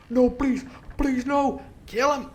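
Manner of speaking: high, loud mock begging; mock pleading then order. Pirates at the massacre, high and loud. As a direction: squeal it like a doomed captive. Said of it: "All the men are like that".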